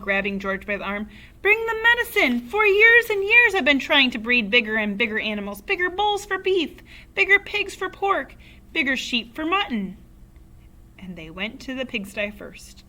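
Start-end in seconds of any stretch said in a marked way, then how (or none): 1.4-10.0 high, loud, eager rant; excited yelling. A narrator's reading. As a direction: rattle off grand plans in a frenzy. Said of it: "Mister Cranky yelled".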